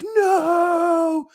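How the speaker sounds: high drawn-out mock scream of "no"; long, loud, imitated cry of doom; movie security guard. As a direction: wail it in a high, hammed-up panic. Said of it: "where the security guard's like".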